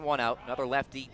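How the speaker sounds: ordinary delivery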